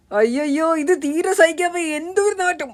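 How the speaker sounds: high-pitched whining at a stink; disgusted wail. Acted. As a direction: wail as if you cannot bear the smell. Acted revulsion at unbearable smell. (നാറ്റം സഹിക്കാതെ നിലവിളിച്ച്)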